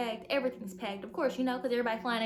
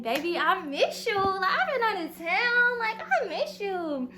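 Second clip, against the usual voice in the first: high, slow, drawn-out whine; whiny mock longing; 8 semitones higher, 6 dB louder than usual. Girlfriend to M J after a trip, a high whiny plea. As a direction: pour it on, overly needy. Greeting M J after being away.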